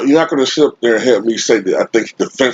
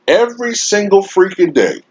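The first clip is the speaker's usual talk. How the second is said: exasperated; 10 semitones higher than usual. Real, not acted. Fan ranting about nonstop LeBron coverage, exasperated. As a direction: pitch it way up, fed up.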